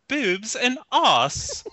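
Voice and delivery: high, very slow posh British imitation; British accent. American mimics British people, hammed-up posh British accent. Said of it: "you hear British people are like".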